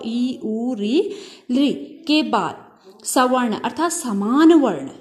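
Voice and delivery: usual speaking voice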